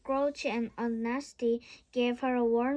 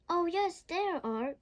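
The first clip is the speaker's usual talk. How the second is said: excited cry; 6 semitones higher than usual. A narrator's reading. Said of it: "cried Millie".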